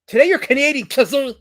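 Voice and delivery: loud, high teasing announcement; playful loud jab at a chat member; teasing call-out. Real, not acted. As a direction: rub it in with a shout.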